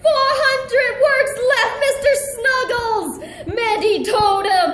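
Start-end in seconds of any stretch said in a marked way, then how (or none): 0.0-3.1 taunting. Acted. Mandy taunting the villain.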